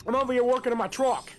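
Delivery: put-on high, loud Boston accent. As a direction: ham up a thick Boston accent. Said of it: "With, like, a Boston accent or something".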